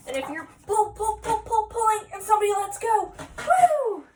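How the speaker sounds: high, loud, rapid build to a whoop; playful straining. Shown as a demonstration. Reader shows children the tug of war, playful excitement. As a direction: act out pulling, then whoop as it releases.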